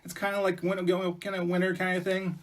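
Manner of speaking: high-pitched chattering squirrels; pleading squirrels. Acted. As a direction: ask for help in a high little animal voice. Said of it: "The squirrels would".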